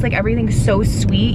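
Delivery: usual speaking voice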